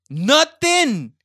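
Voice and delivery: high, loud drawn-out Nothing; indignant yell of Nothing; outraged shout. Acted. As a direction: blast the word Nothing. in disbelief. Repeats the word, then shouts it.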